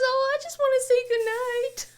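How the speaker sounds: put-on very high, sugary kid voice; sweet daughter. Mom imitating daughter wanting to say goodnight, sugary high voice. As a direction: coo it innocently. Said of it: "She goes".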